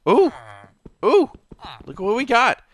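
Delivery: high, loud excited squeal building up; excited discovery. Real, not acted. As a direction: gasp with delight at the find. Finds dinosaur DNA in vat.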